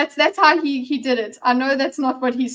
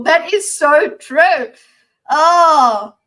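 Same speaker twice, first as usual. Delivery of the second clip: emphatic agreement; 5 semitones higher, 10 dB louder, much slower than usual. Real, not acted. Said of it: "reacting to the comment".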